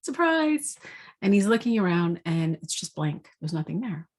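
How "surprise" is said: high toy voice, deflated; the second surprise falls flat; puzzled jack-in-the-box. Retold or imitated. Jack-in-the-box finds nobody there, high and let down. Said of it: "he's like".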